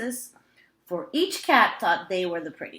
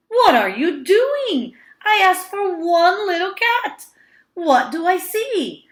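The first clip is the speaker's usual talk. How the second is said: put-on high, loud dismayed old woman; crying out; 11 semitones higher, 9 dB louder than usual. A narrator's reading. Old woman crying out at her husband, high and loud. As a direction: cry out in alarm at the cats. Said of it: "she cried".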